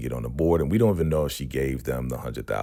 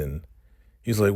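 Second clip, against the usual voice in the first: usual speaking voice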